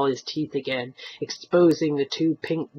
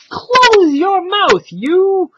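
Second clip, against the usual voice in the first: very loud, high, slow furious order; furious shout; 14 semitones higher, 10 dB louder, much slower than usual. Acted. Old man silencing the stranger, furious shout. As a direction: blast him into silence.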